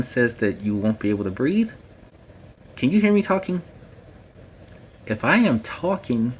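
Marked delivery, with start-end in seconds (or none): 2.8-3.6 pitched way up and louder, a pointed question; rhetorical challenge. Real, not acted. Rhetorical challenge on mask breathing. 5.1-6.3 emphatic proof. Real, not acted. Proving point about masks.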